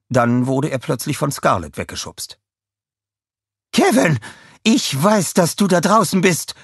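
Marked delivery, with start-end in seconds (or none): 3.7-6.5 high, loud villain shout; villainess yelling. A narrator's reading. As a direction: shout threats into the camera. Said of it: "schrie sie in die Kamera". (lauter, hoher Schrei der Schurkin in die Kamera)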